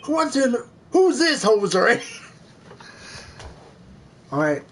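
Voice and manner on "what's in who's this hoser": loud, higher Canadian judge. Acted. Judge confronting an intruder, Canadian accent. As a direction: blurt it as an indignant Canadian judge. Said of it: "this judge is Canadian".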